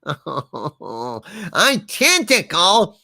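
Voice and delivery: slowly stretched out, rising at the end; milk the joke word by word; drawn out punchline. Shown as a demonstration. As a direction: savour the pun slowly.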